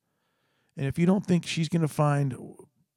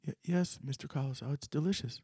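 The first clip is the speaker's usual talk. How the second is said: put-on timid girl; 9 dB quieter than usual. Shy scared girl answers.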